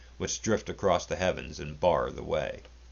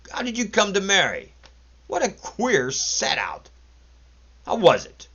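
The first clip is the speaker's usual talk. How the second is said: high mocking; 13 semitones higher, 6 dB louder than usual. A narrator's reading. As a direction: egg him on with ridicule. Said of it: "less brutal and more mocking".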